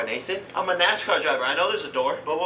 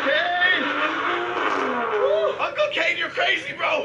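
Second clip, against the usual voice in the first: excited cheering; 11 semitones higher, slower than usual. Real, not acted. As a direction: cheer him on from the side. Cheering during donuts.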